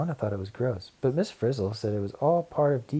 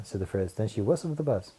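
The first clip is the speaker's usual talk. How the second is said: plain reading voice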